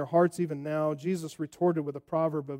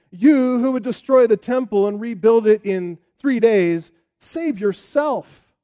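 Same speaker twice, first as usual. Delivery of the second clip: 크고 천천히 조롱하는 외침; 군중이 십자가 위 예수를 비웃듯, 가볍게 조롱하며; mocking taunt; 3 semitones higher, 9 dB louder than usual. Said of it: "They're wagging their heads and they're saying".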